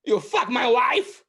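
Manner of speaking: high, loud, slow mock threat; hammed-up jealous husband yelling; mock accusing shout. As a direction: snarl it at your own pants as a joke. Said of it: "and he goes".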